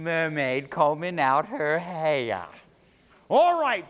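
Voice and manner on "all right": high, very loud outburst; exasperated yell. A narrator's reading. Fisherman fed up with the mermaid's calls, exasperated. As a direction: burst out loudly, fed up.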